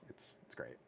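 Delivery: a whispered afterthought; whispered aside. Real, not acted. Trailing off to chat about beach reading, whispered. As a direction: drop to a whisper to finish the thought.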